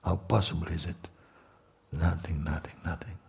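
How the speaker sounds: hushed meditation voice with long pauses; guided meditation. Real, not acted. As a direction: guide them into emptiness, leaving long silences.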